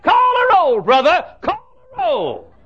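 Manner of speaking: high, loud southern preacher calling out; calling out from the pew in a drawl; old preacher's drawl. Retold or imitated. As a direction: shout him on like an excited old preacher.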